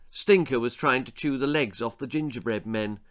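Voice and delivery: ordinary delivery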